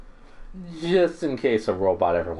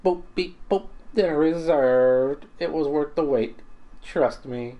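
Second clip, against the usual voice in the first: higher, bright-eyed robot chef with beeps; a cheerful cooking robot, sure of himself; 12 semitones higher, much slower than usual. Acted. Robot chef boasting to the kid about cooking, higher beeping voice.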